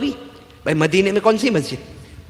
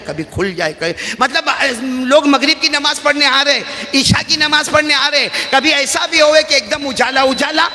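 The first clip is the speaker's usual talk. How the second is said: higher, louder storyteller preacher; lively preaching; 8 semitones higher, 7 dB louder than usual. Acted. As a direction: paint the flickering light for the crowd. Vivid preaching, raised. (بلند آواز میں جوشیلا بیان)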